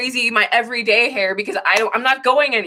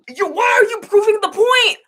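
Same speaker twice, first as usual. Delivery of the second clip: emotional shout; 13 semitones higher than usual. Real, not acted. Shouting at commenters who prove her point. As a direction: yell it, half laughing in disbelief. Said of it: "I'm way too emotional".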